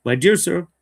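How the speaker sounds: hint of a bad Australian accent. Shown as a demonstration. Host finally giving the letter a bit of bad Australian accent. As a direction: slip a touch of Australian into it. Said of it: "hard not to do a bad Australian accent".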